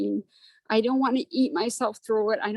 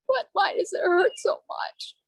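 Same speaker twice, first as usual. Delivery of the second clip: higher voice breaking into real tears; crying; 9 semitones higher than usual. Real, not acted. Grieving woman asking her support group, crying. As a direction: let the sob break through the question. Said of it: "I'm gonna try not to cry".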